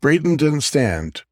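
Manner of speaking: ordinary delivery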